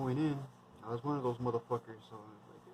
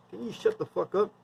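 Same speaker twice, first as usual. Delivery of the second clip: mock telling off; 7 semitones higher, 5 dB louder than usual. Retold telling off a loudmouth.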